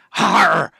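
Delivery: hearty pirate har; pirate laugh. Shown as a demonstration. Host demoing the pirate laugh, hearty har. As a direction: bark it like an old buccaneer. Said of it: "It's a pirate's laugh".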